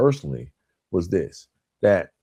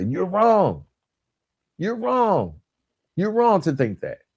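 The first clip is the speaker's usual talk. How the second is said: accusatory; 9 semitones higher, slower than usual. Retold or imitated. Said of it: "Or being accusatory, I used to say".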